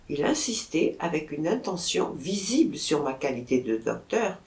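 plain reading voice

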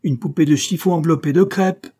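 loud, high tirade; angry scolding. A narrator's reading. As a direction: rail at him in exasperation. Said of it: "grogna l'adjoint".

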